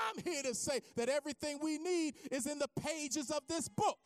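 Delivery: loud, high-pitched preacher's climax; full-force preaching. Real, not acted. Preacher urges congregation to open the Bible, impassioned. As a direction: drive it home like a fired-up preacher.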